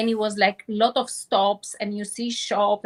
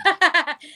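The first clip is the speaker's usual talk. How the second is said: laugh; 9 semitones higher, 6 dB louder than usual. Real, not acted. Laugh while telling dream.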